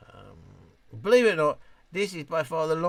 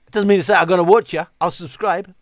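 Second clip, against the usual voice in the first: loud joking; 3 semitones higher, 15 dB louder than usual. Real, not acted. Loud joking rant to chat.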